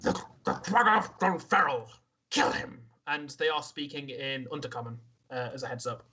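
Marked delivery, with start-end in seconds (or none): 0.0-2.8 lightly voiced enemy giving a kill order; a hint of a harsh creature barking the command; monster commanding. The Monitor ordering its allies, lightly voiced command.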